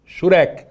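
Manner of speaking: shurek, each syllable crisp with a falling end; teacher repeating the vowel name for the class; Hebrew word model. Shown as a demonstration. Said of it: "Diga comigo".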